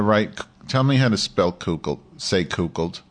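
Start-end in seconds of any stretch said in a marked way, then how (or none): none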